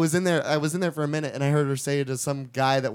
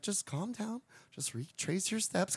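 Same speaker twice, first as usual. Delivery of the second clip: much softer, slow soothing; calm down; 3 semitones higher, 13 dB quieter than usual. Retold or imitated. Clerk calming the panicking customer, soft and slow. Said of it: "the one chick is like".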